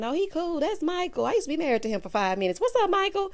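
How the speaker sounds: high, breezy mock voice; pretend Jasmina. Playing Jasmina waving off her ex, high and breezy. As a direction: toss it off like an ex who doesn't care. Imagining Jasmina casual about Michael.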